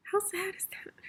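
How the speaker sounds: hushed, sad whisper. Real, not acted. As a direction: breathe it out sadly. Sad aside about the widower.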